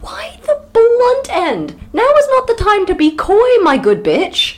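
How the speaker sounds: high, loud incredulous protest; exaggerated disbelief; mock outrage. Real, not acted.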